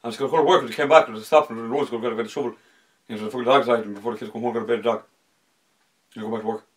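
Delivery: loud, rapid-fire rural Irish, words run together; culchie Irish accent. Shown as a demonstration. Host showing how country folk speak, thick rural Irish gabble. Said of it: "That's genuinely how they sound".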